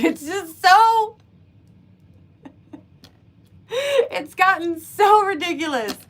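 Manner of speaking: laughing. Real, not acted. Laughing at absurdity.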